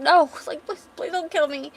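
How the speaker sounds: high, panicked begging; acted terror, pitched well up; desperate pleading. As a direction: plead like a frightened boy. Acted boy begging for his life.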